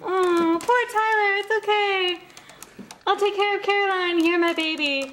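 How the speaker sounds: high sugary mock tenderness; doting mother. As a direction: comfort your baby boy melodramatically. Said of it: "scene of the week".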